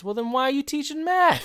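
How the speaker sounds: hammed-up incredulous student; incredulous comeback.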